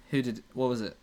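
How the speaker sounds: ordinary delivery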